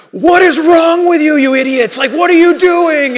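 put-on loud, high furious yell at the kids; shouting angry father; dad's fury. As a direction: blow up at the kids like a furious dad. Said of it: "the fury of a dad came out".

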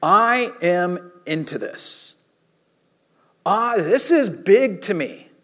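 I AM INTO THIS slow, each word weighted; emphatic conviction. Acted. Preacher voicing Jesus making his important point, slow and emphatic. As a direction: draw out BIG with earnest emphasis. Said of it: "to make an extremely important point".

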